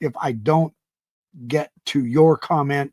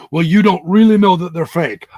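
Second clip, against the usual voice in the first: louder mock objection; objecting doubter; 3 semitones higher, 7 dB louder than usual. Doubter challenges the host's verdict, mock objection. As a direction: imitate a pushy doubter.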